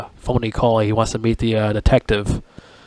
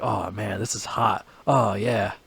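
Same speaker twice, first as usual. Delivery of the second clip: hushed pervy gloat; 2 semitones lower, 11 dB quieter, slower than usual. Retold or imitated.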